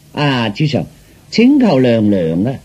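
ordinary delivery

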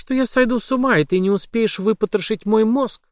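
high, defiant blurt; ringing blurt. Acted. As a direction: fire it in his face, ringing. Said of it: "звонко выпалила своему тюремщику в лицо".

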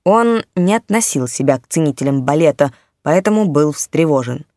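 ordinary delivery

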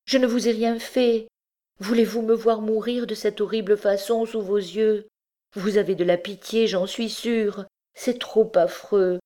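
high-pitched, frantic pleading; desperate plea for mercy, pitched high; mad with terror. A narrator's reading. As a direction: beg for your life in terror. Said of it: "folle d'effroi".

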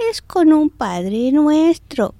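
slow punchline. Retold or imitated. Nun reveals the real miracle to the visitors, slow and sly. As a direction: savor the pun, milk every word.

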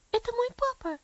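put-on high and hopeful, quivering child; eager, trembling question. A narrator's reading. Sick girl hearing a carriage, asking for her father. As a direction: ask it in a hopeful rush. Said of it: "вздрагивая и дрожа".

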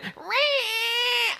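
sustained high squeal of a door; very high, drawn-out door noise; creaky door imitation. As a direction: imitate a hinge creaking open. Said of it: "her door goes".

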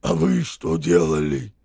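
low, loud soldier's demand; low loud soldier question; gruff soldier. A narrator's reading. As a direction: bark it down at them.

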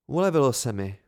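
ordinary delivery

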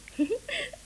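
high bright giggle; giggling at the donkey ears. Acted. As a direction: let a giggle burst out. Children giggling at memory.

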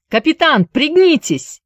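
high, loud warning cry; urgent shout. Acted. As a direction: yell the warning to the captain. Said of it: "успел крикнуть Джаред".

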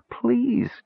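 high, whispery plea; begging. A narrator's reading. Kender clinging to the cleric's robes, begging. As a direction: beg in a small high voice. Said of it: "Tass begged".

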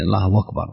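"الله أكبر" said as Allahu wakbar, the hamza replaced by a w; hamza turned to waw. Shown as a demonstration. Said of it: "إبدال الهمزة، آه، واوا".

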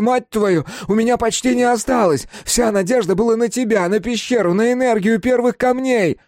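high, loud hysterical tirade; anguished shouted accusation; yelling, voice breaking. A narrator's reading. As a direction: rant at the top of a cracking voice. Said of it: "заорал он ломающимся голосом".

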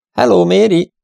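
high, loud hail into the house; calling out. A narrator's reading. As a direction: call to announce you have arrived. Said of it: "kiáltotta, hogy megérkezésüket jelezze".

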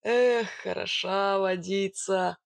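slow, relishing bogatyr voice; satisfied strongman drawl; appreciative. A narrator's reading. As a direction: savour it with a drawn-out sigh of approval. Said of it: "оценил он".

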